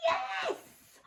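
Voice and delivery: very high exclaimed yes; delighted high cheer; excited yes. Real, not acted. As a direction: celebrate the ending. Storyteller cheers with children.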